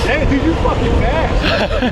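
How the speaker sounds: loud excited shout over wind. Real, not acted. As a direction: yell it out mid-ride. Shouting while riding fast.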